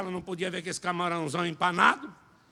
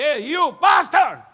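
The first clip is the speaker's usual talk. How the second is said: loud high shout across the room; calling out; 8 semitones higher, 13 dB louder, much slower than usual. Retold or imitated. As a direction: call him out from the front. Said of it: "Ele disse".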